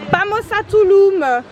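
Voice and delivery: high, slow, loud cheer; excited shout. Real, not acted. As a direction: belt it out like a rallying cry. Cheering as they leave.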